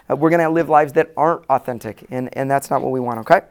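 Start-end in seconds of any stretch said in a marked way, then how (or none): none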